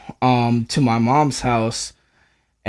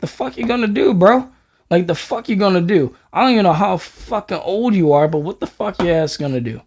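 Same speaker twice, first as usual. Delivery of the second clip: 1 retold challenge; 6 semitones higher than usual. Confronting Chino in a fight.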